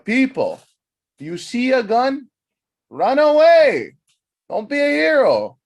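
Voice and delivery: high, loud, drawn-out warning; comic rant at full volume; ranting shout. Acted. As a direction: holler each phrase slowly in exasperation.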